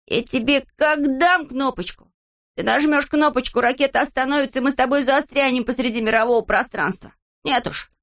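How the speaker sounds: high, indignant telling-off; exasperated high-voiced rebuke; angry scolding. Acted quarrel, Незнайка threatens Пончик.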